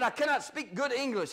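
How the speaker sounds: normal talking voice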